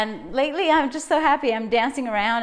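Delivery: normal talking voice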